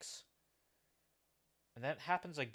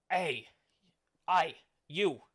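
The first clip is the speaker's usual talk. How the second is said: loud, raised-pitch challenge; pirate calling out; 6 semitones higher, 8 dB louder, slower than usual. Acted. Pirate spots a passenger who spoke up, loud challenge. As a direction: call out sharply to single someone out.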